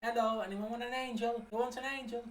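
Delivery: high, sing-song seller's pitch; hawking angels. Retold or imitated. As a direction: peddle angels to passers-by, up high. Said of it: "goes around while they're lighting the tree going".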